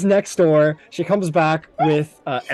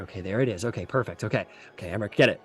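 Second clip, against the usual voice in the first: lower, softer, steady instructions; calm and focused; 7 semitones lower, 8 dB quieter, faster than usual. Acted. Healer guiding the pull, quiet and lower. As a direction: murmur it, concentrating on the wound. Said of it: "goes like".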